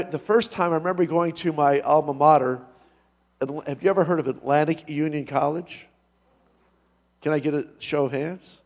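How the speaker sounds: usual speaking voice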